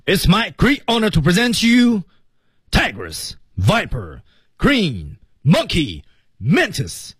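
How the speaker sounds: high, ringing ceremonial announcement; booming host presenting champions; grand announcer. Acted. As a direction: proclaim to the whole valley like a master of ceremonies. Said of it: "它是怎么说的呢".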